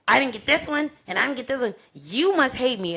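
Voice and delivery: imitating a sulky partner at dawn; mock accusing boyfriend. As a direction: mimic him complaining he was left out. Boyfriend's accusing texts voiced.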